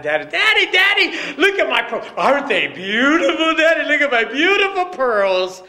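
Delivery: put-on very high, loud, thrilled child; excited little girl. Little girl shows daddy her pearls, thrilled and very high. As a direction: squeal with delight for daddy. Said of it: "she was so excited".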